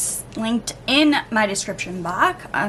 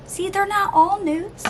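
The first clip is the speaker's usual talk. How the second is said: high, bright-eyed told-you-so; sing-song gotcha; playful tease; 10 semitones higher than usual. Real, not acted. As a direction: tease the audience, pitch up high. Teasing the viewer.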